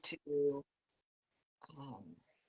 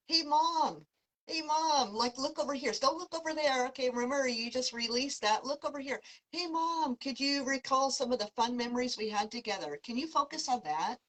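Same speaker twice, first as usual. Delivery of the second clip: put-on higher, lively young voice calling out; a cheerful girl tugging at her mom's attention; vibrant voice; 6 semitones higher than usual. Daughter urging her grieving mom to look at happy memories, vibrant and high. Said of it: "really a vibrant voice".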